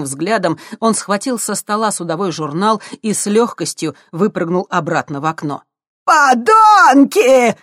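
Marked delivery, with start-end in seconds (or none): 6.1-7.6 long, high furious scream. Acted. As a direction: scream it at them in rage. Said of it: "завопил старик".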